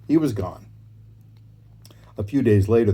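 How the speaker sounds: usual speaking voice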